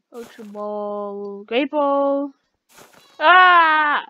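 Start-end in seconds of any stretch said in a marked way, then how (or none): 3.2-4.0 long, high-pitched yell; loud scream. Real, not acted. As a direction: scream out suddenly. Cries out while shopping.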